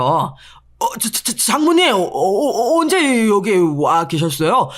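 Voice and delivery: stuttering, nervous laugh, then slow; flustered stammer. Acted. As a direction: caught red-handed, fumbling for words. Husband caught by mother-in-law. (장모님께 들킨 사위의 당황한 말투)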